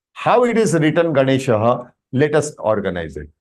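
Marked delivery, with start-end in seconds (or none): none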